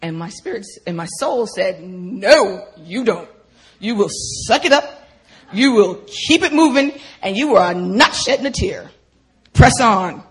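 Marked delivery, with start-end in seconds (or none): 1.8-10.2 loud, stern command to oneself; harsh inner drill sergeant; harsh self-talk. Retold or imitated. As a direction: order it forcefully, hitting each phrase hard. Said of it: "It was really harsh".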